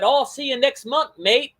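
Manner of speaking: a hammed-up British imitation of a friend; bad British accent. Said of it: "that's a terrible British accent".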